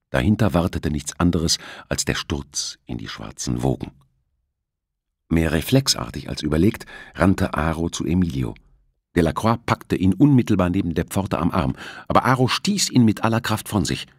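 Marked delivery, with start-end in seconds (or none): none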